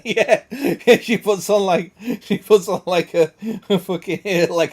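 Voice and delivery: amused, words broken up by laughter; cracking up while explaining; laughing. Real, not acted. Amused by partner's accent joke.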